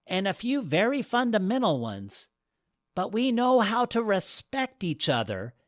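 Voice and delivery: a goddess reassuring the couple; serene goddess. Acted. Goddess explains herself.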